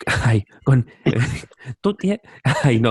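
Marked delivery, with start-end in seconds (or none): 1.8-2.2 high-pitched mock scolding voice; Gary Neville. Mocking a footballer out in his Prius, high put-on voice. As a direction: squeal it as a comic caricature. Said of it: "Gary, Gary Neville was out".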